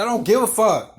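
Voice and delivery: loud retold brush-off; defiant retort. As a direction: re-enact firing back at her. Retold retort to old lady.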